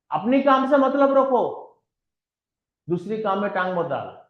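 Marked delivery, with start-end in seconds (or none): 0.1-1.7 higher and louder, hammering the point home; loud emphatic version. Shown as a demonstration. Speaker drives the same advice home to the audience, loud and high. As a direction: raise the voice and push it out. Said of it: "Mind your own business".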